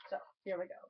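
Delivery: normal talking voice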